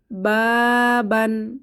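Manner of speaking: বাবান with the middle ba-a held out long, slowly; long alif stretch. Shown as a demonstration. Teacher demonstrates to students, বাবান very slowly with long alif. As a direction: draw out the alif in বাবান a full beat. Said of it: "এক আলিফ টেনে পড়ব".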